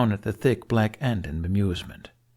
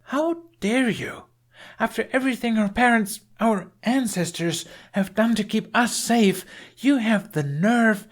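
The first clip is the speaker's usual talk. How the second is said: furious yelling; 13 semitones higher than usual. A narrator's reading. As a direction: rail at him word by word. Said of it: "after all the yelling".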